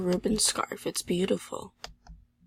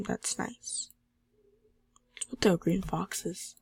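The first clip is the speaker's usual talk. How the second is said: ordinary talk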